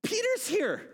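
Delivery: high, giddy news-breaking; excited announcement. Acted. Rhoda telling the praying believers, excited. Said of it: "She's so excited that she goes".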